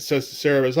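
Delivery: ordinary talk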